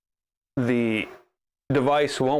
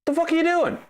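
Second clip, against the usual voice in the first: startled, much higher exasperated cry; shocked squeak; 14 semitones higher, faster than usual. Real, not acted. Startled high cry at the misbehaving printer. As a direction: yelp at the printer in disbelief. Printer suddenly does something wrong.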